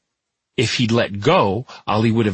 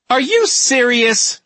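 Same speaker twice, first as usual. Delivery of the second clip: slow, much higher whine; whined; 5 dB louder, slower than usual. A narrator's reading. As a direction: drag out the complaint in a high whine. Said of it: "Coulter's mother whined".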